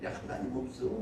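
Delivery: soft, hushed tempter's aside; devil's whisper. Retold or imitated. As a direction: whisper doubt into a believer's ear as Satan. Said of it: "귀에 속삭이는 거예요". (귀에 속삭이는 사탄 흉내)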